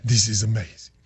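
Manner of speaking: low, quiet put-on voice; Milan impersonation. Shown as a demonstration. Imitating a player looking up at the crowd, low impersonation. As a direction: drop low into another man's voice. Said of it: "that's my Milan impersonation".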